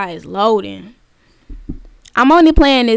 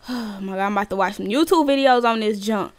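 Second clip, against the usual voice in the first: ordinary delivery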